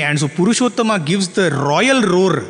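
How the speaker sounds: usual speaking voice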